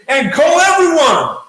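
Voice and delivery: high, loud burst of hype; mock rallying shout. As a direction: belt it out like a pushy team leader. Mimicking the uplines' standard instruction.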